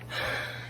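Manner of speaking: long shaky breath out; heavy sigh. Real, not acted. Anxious vlogger sighs.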